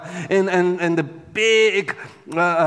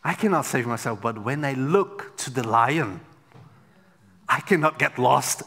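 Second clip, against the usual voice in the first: usual speaking voice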